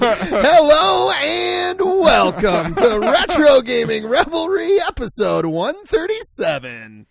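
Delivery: loud, slow, laughing announcer intro; excited show intro. Real, not acted. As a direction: ham up the podcast welcome. Tipsy show intro after champagne.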